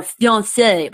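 fiancé in clumsy, overdone French; struggling French. Shown as a demonstration. Learner feeling dumb trying French, fiancé awkwardly pronounced. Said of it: "I feel so dumb".

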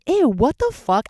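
grossed-out ew; disgusted. Retold or imitated. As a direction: recoil in disgust. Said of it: "I was just like".

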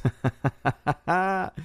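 amused chuckle after a joke; short run of light laughter. Real, not acted. Streamer amused by a viewer's flirty comment, chuckling.

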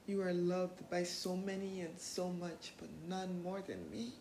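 slow, soft and tender; a soft, slow affirmation; Doctor Who quote. Shown as a demonstration. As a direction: say it gently, like a promise to yourself. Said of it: "That's a quote from Doctor Who".

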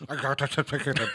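laughing, with marbles in mouth; lopsided jaw, mumbly, laughing. Shown as a demonstration. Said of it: "I gotta have the marbles".

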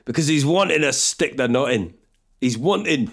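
badly attempted Scottish accent; wobbly Scottish accent. Retrying the nut line, wobbly Scottish accent. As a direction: try Scottish and let it wander. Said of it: "what's going on with my accents?".

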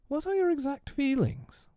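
very high-pitched, careful question; trying to be firm. A narrator's reading. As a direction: attempt firmness in a high voice. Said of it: "trying to speak firmly".

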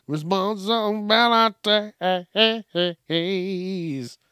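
very slow, stretched out word; slow mocking drawl. Shown as a demonstration. Comedian mocking kids who dodge chores, stretched out slowly. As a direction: drag Responsibilities out syllable by syllable.

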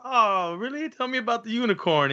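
high, playful curiosity; mock encouraging parent. Parent encouraging kid's fantasy, high playful interest. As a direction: egg the kid on, sweetly curious.

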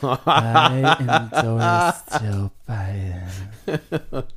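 put-on slow, drawn-out indie girl voice; [name] voice. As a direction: drag it out like a moody indie singer. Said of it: "I do a really good indie girl voice".

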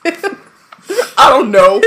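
high, slow giggling; laughing. Real, not acted. Friend laughing while retelling the phone mixup. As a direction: laugh the words out helplessly.

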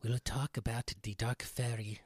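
put-on playful Scandinavian accent, storyteller style. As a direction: Scandinavian accent, gather the children round. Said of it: "in a Scandinavian accent for authenticity".

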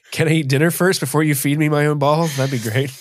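ordinary talk, nothing marked

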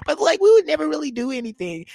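put-on high, fast girly voice; high quick mock innocence; mock girly excuse. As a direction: imitate a woman brushing it off. Mocking women's excuse.

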